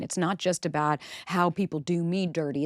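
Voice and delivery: usual speaking voice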